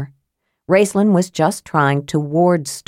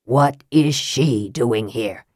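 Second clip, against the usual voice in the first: low, slow, cold demand; low slow Raistlin demanding answers; 6 semitones lower, slower than usual. A narrator's reading. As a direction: pin him with the question. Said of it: "he demanded".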